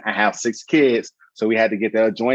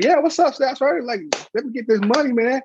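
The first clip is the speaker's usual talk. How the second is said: mock pleading; 12 semitones higher than usual. Pleading with the sergeant.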